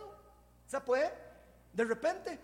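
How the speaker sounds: ordinary talk, nothing marked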